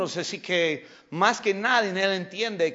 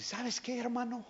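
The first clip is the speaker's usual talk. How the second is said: quiet take; 5 semitones higher, 9 dB quieter than usual. Retold or imitated. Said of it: "Así me dijo".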